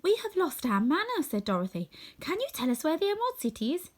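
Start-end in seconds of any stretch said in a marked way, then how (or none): 0.0-1.2 put-on higher young girl voice. Dorothy voiced. 2.2-3.9 put-on higher young girl voice. Dorothy voiced.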